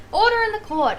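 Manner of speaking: high, ringing call to the court; judge calling order. Acted. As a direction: pronounce it loudly from the bench. Game's judge calls for order.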